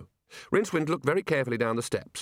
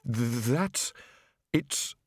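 halting, gasped stammer; gasping; much slower than usual. A narrator's reading. Rincewind just pulled back from the edge, gasping. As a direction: stammer as if robbed of breath. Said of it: "Rincewind gasped".

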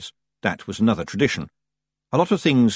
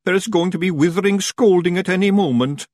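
hammed-up high lament; moaning dread; 9 semitones higher, 4 dB louder than usual. A narrator's reading. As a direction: moan in dread as a wee Scotsman. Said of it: "moaned Daft Woolly".

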